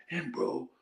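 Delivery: ordinary delivery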